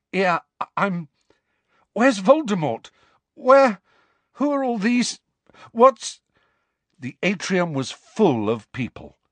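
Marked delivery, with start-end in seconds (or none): 0.1-6.2 put-on high, halting, panicked boy; shaken Harry. Dazed boy after the duel asks Dumbledore, high and breathless. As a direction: stammer out questions in shock. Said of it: "shaking so violently he could not hold his head up".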